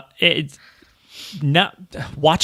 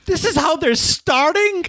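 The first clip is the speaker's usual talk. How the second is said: mock dismay. Retold dismay at the opening scene.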